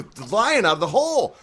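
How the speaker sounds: ordinary talk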